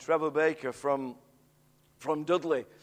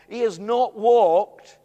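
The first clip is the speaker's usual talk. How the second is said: slow, louder, stressing every word; emphatic; 8 dB louder, much slower than usual. Retold or imitated. Pastor protesting to the preacher, slow and emphatic. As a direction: hammer home that he cannot walk. Protesting man cannot walk.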